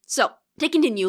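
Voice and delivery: ordinary delivery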